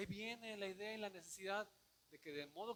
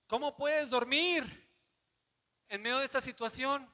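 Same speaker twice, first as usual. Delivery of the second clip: captain shouting; 7 semitones higher, 16 dB louder than usual. Retold or imitated. Captain shouting at sleeping Jonás in the storm, loud and high. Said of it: "Le grita".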